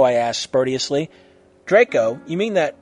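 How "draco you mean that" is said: louder, indignant retort; angry snark. A narrator's reading. Friend scorning the one who betrayed her, angry snark. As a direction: throw it back with scornful anger. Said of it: "snarked with anger".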